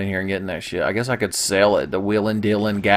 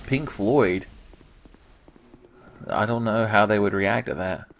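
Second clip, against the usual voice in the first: ordinary delivery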